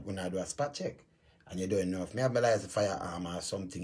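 normal talking voice

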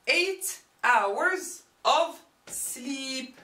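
very slow, even teacher delivery; very slow, deliberate gloss. Shown as a demonstration. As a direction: stretch each word of the translation out. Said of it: "teacher gives slow model translation".

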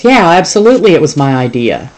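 brash and eager down the line; zoo boss on the phone. Acted. Boss takes the credit.

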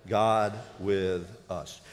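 "God with us" drawn out slow and low; slow unison. Shown as a demonstration. Preacher leads the church in a slow "God with us". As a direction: lead the room through "God with us" word by word. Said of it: "say it with me".

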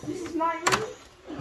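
high, slow, drawn-out delight; proudly showing off the room in a high sing-song. Pretend. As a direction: sing it out high like a happy child. Arriving in hotel room, repeats it.

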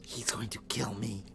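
tense whisper. Real, not acted. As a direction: breathe it out, bracing for death.

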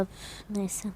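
a soft exasperated whisper; whispered sigh. Real, not acted. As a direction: sigh it away under your breath. Muttering to self while playing.

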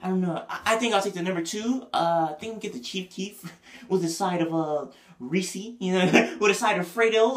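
a lightly voiced girl ordering lunch; mock girl ordering. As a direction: play an indecisive girl at the counter. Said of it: "your, like, girl in front of you".

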